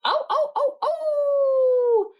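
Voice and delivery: very high yelping barks; wild dog barks. A narrator's reading. Wild dog snapping at Paddington, high yelps. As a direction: yap sharply like a wild dog. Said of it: "the Siberian wild dog went".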